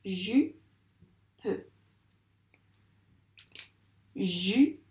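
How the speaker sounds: each "Jupe" split into ju, long pause, pe; syllable by syllable. Shown as a demonstration. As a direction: dictate "Jupe" one syllable at a time. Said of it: "deux ronds pour chaque syllabe".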